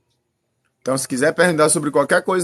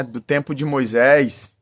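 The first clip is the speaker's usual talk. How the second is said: usual speaking voice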